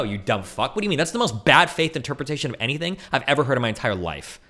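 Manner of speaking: normal talking voice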